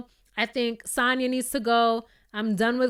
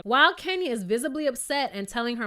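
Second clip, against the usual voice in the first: usual speaking voice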